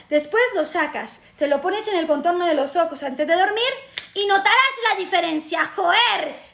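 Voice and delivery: hammed-up commercial in a Castilian Spanish accent; put-on Spain accent. Explaining the roll-on to viewers, put-on Spain accent. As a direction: gush like a Spanish saleswoman, accent laid on thick. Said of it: "mi acento español".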